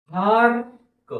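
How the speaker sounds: Hark stretched out slowly, sound by sound; slow blending. Shown as a demonstration. Teacher blending a word slowly for pupils. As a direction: draw out hark for the class. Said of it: "हळूहळू सावकाश म्हणून दाखवत आहे".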